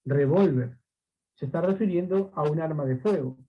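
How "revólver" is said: Revólver, weight on the second syllable; stress on middle syllable. Shown as a demonstration. Teacher naming the firearm word, stress on VÓL in Revólver. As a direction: lean on the O of Revólver. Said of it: "la tilde está en la O".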